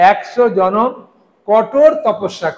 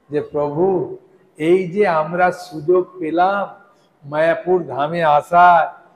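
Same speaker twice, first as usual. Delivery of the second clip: ordinary talk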